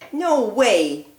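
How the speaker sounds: normal talking voice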